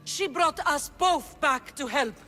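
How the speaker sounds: heated defence. Acted. Daughter defends her family before the kings, heated. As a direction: argue back, pitch climbing, slowing at the end.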